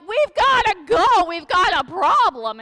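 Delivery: urgent alarm, shouting we must go; panicked disciples. Retold or imitated. Disciples yelling at sleeping Jesus in the storm, panicked.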